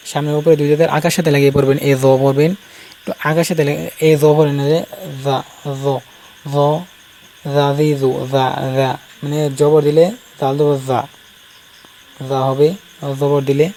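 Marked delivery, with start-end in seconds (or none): 5.2-8.9 জা with the tongue tip touching the upper front teeth; tongue to teeth for each জা; Arabic zal. Shown as a demonstration. Teacher drills Arabic zal syllables for students. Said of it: "জাল জিহ্বার আগা".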